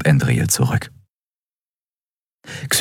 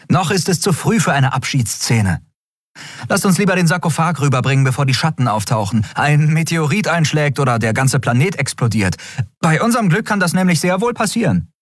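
put-on higher, quick, impatient young captain; Endriel, brisk captain; 11 semitones higher, faster than usual. A narrator's reading. Captain Endriel urges the crew to move on, higher and quick. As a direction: hurry them along, half joking.

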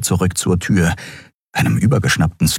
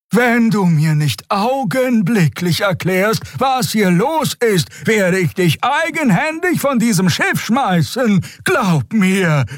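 slow, high, stern ultimatum; threatening; slower than usual. A narrator's reading. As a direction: lay down the threat with total authority. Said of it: "sie könne ihre Drohung wahrmachen". (langsam und drohend)

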